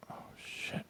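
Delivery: barely audible, breath-only curse; whispered dread. Retold or imitated. Teacher warned of snakes in the grass, whispering in dread. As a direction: whisper it as the news sinks in. Retells dread after the warning.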